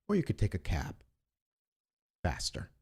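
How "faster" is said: ordinary talk, nothing marked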